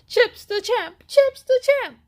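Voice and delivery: high, loud crowd cheer; screamed cheer. A narrator's reading. Crowd of cheetahs cheering the winner, screaming. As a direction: cheer the winner at the top of your lungs. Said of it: "everyone screamed".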